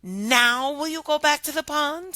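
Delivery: high princess; high hopeful princess. Acted. Princess voiced in story.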